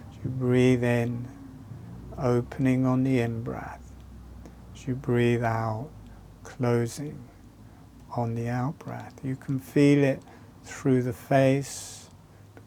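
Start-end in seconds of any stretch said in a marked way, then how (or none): none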